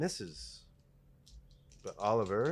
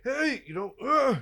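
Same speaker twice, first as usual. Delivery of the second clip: squeaky high startled voice; startled captive; 10 dB louder than usual. Acted. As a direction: jump up high in alarm. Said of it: "he's like".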